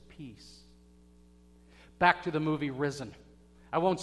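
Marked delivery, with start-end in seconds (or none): 0.1-0.6 very soft, hushed "Peace"; Jesus softly greeting his disciples; gentle blessing. Acted. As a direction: breathe peace onto the disciples. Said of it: "and he says".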